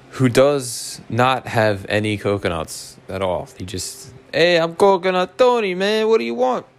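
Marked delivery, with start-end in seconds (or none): 4.3-6.7 put-on Italian accent. Beach hustler greeting customers, mock Italian accent. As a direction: put on a high-pitched comic Italian accent. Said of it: "I'm making him sound Italian".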